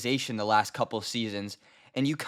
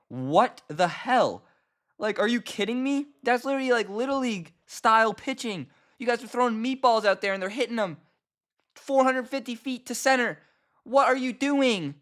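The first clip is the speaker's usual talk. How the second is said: exasperated rant; 7 semitones higher than usual. Real, not acted. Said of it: "I'm so upset".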